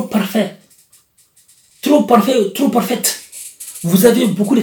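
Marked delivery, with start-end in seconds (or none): none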